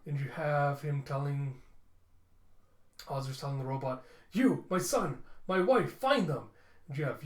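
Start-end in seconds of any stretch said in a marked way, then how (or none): 4.3-6.5 higher, louder desperate orders; urgent shout. Acted. Ozris ordering the robot to find his family, urgent shout. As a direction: bark the command to save his family.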